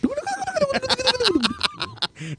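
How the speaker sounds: high-pitched garbled rewind babble; mouth-made rewinding gibberish; tape rewind noise. Pretend. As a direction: imitate a squealing tape rewind.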